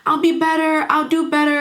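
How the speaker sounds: high, desperate imitation of begging; mild mock scream. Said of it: "she's screaming".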